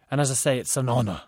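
"And as I say, it's an honour" run together fast; rushed into one word. A narrator's reading. Designer repeats his greeting, all run together. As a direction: blur the phrase into a single word. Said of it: "so quickly it was almost like one word".

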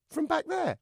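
high puppet query; amazed dummy. Acted. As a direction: ask in a high, wondering voice.

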